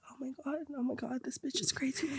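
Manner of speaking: breathy unvoiced whisper. Thinking about the crazy roommate, whispered. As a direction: whisper it as a secret aside. Inner thought while roommate begs.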